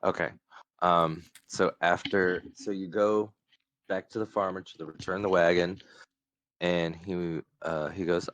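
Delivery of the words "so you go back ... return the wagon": normal talking voice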